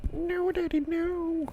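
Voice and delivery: tiny high-pitched plea; mock pleading child. Joking as a child pleading with daddy, high mock plea. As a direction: beg like a little kid.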